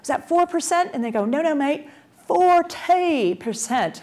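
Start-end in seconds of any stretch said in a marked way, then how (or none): 0.0-0.9 high-pitched, mock-puzzled query; double-check in acted disbelief; retold baffled question, pitched up; incredulous question. Acted puzzled reply to Australians. 1.2-4.0 high, hammed-up Aussie correction; exaggerated Australian accent, stretched at the end. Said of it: "try to imitate an Australian accent".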